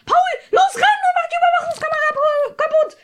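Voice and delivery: high, loud shouted command to Paul; shouted order. Acted. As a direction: bark the order across the room, loud and high. Gives an order inside the roleplay.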